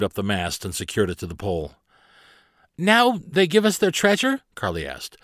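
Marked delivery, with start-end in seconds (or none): 2.8-4.4 high, eager questioning voice; curious girl captain. Acted. Carly asks Barnabas about the treasure, high and eager. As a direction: ask like a hopeful child, pitched well up.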